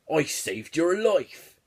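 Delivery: hammed-up angry retort, drawn out; mock angry yell. A narrator's reading. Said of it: "he yelled angrily".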